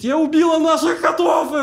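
high, loud horrified cry; panicked shout. Retold or imitated. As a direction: cry out in horror. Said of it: "Она кричит".

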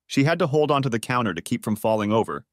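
plain reading voice

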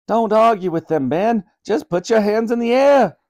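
Granny shouting to Ben over the din; shout over noise. A narrator's reading. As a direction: yell over the helicopters. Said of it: "shouted Granny over the noise".

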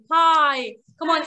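usual speaking voice

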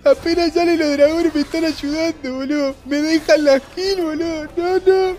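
high, whiny fake sob voice; mock crying. Thanking teammates who helped with dragons, mock crying. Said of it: "me voy a poner a llorar".

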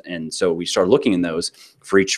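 normal talking voice

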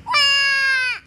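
loud, high, long "waah"; acted wail of a frightened little one; scared wail. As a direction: cry out in hammed-up fright. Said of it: "George is scared of his".

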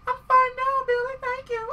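high put-on girl voice; mock girly voice. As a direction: squeak it like the rescued heroine. Voicing Rebecca thanking Billy.

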